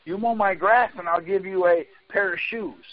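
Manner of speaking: ordinary delivery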